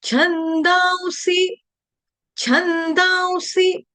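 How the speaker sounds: high, slow छंदांसी said as chhandaun-si; nasal glide to 'aun' before सी in छंदांसी; correct nasal pronunciation. Shown as a demonstration. Teacher modelling the word, slow high intoned pronunciation. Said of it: "छंदांसी गलत उच्चारण है".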